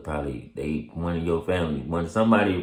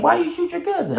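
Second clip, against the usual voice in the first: very high, louder mock question; imitating the officer; 5 dB louder than usual. The officer questioning him, squeaky and high. Officer asks about shooting.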